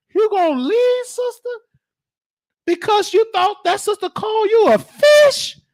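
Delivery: can't believe she'd leave over this; incredulous. Acted. Bishop scolds the sister, shouting high in disbelief.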